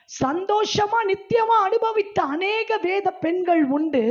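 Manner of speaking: high, loud, fervent pulpit preaching; preaching at full force. Acted. Preacher rousing the women's meeting about joyful biblical women, full-force preaching. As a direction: lift the congregation with rising fervour.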